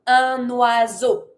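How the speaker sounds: "Un oiseau" slowly, N linked into "wa-zo"; N liaison. Shown as a demonstration. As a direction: carry the N of "Un" onto "oiseau". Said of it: "take my N from un to connect it".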